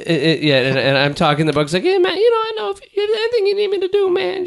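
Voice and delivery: squeaky high laid-back stoner; helium-high chill dude; high-pitched stoner voice. Retold or imitated. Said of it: "That's what I sound like".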